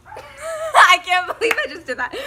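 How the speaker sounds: laughing hard and loud, high through the words; real giggly disbelief; embarrassed laughing. Real, not acted. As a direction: burst out laughing in disbelief at yourself. Laughing at her own on-camera moment.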